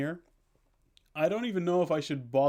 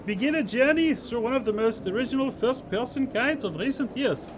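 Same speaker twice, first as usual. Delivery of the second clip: reads the blurb in a higher, hammed-up Austrian accent; mock Austrian accent; 9 semitones higher than usual. Streamer reads Steam blurb to chat in a mock Austrian accent. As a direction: put on a jokey Austrian accent for the game description. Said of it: "hear my wonderful German accent".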